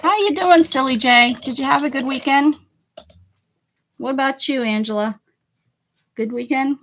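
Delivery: high, loud, bright hello to viewers; excited shout-out to a viewer; cheery greeting. Real, not acted. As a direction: call out a happy hello. Greeting livestream viewers by name.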